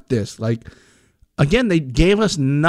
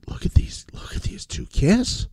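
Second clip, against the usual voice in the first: whispering; 3 semitones higher, 6 dB quieter than usual. Retold or imitated. Whispered retold thought.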